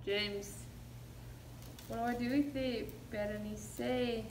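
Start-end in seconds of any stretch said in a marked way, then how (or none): none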